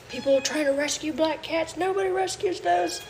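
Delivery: high-pitched mimicry of worried critics; mock alarmist. Imitating people who fear Halloween sacrifice, high mock alarm.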